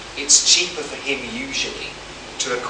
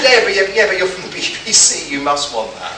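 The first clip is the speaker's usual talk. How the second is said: laughing; 6 semitones higher, 7 dB louder than usual. Retold or imitated. The driver insisting on the offer.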